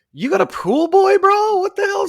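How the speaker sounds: high, loud imitation of a partygoer; mock incredulous. Partygoers reacting to the new pool boy, mock incredulous. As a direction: play up the disbelief, pitched high.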